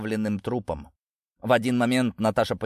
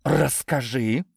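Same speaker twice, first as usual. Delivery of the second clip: slow, drawn-out eerie demand; sepulchral voice; 5 semitones higher, much slower than usual. A narrator's reading. Natasha demanding the truth from Lidia, sepulchral voice. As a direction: demand it as if from the grave. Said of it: "загробным голосом потребовала Наташа".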